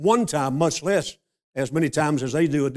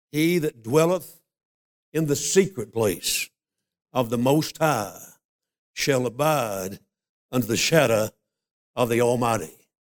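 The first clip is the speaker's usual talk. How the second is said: scripture reading; 4 semitones lower than usual. Acted. Reads a psalm verse aloud.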